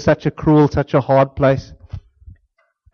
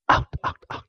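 whispered firm out; whispered shoo; 10 dB quieter than usual. Retold or imitated. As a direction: whisper it away. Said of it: "Churchill shooing dog".

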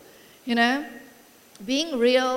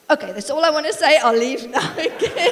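put-on quick, louder little-kid interjection; child excusing himself; 3 semitones higher, 7 dB louder than usual. Young son butting in on parents' argument, hurried and earnest. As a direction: rush it out like a child, amused.

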